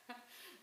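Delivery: a quick soft laugh in her throat; short amused laugh. Real, not acted. Minister to congregation, amused at a missed email, soft laugh. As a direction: laugh off the absurd complaint.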